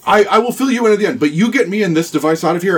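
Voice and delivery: eager bargainer in character. Acted. The captive bargains with the party.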